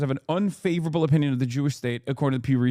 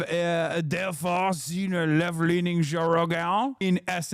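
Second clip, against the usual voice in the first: reading the article in a slow, put-on Quebecois French accent; slow French Canadian lilt through the whole read; slower than usual. Streamer reads a news article aloud in a Quebecois accent. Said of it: "This is the one accent that is a tragedy".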